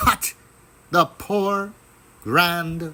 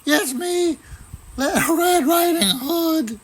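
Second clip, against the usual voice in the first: put-on much higher, sweet girl's voice; little girl voice. A narrator's reading. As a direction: sound like a small innocent girl. Girl character voice.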